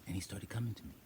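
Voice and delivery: hushed, awed whisper. Real, not acted. Telling how the goat came to her, whispering. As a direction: breathe it out in wonder.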